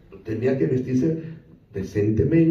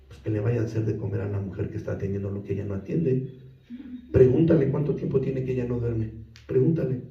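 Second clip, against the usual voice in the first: inner voice; 7 semitones lower, 6 dB quieter than usual. Acted. God's answer in prayer.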